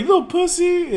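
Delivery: high mocking jeer; lightly mock the cousins' taunt; taunting cousins.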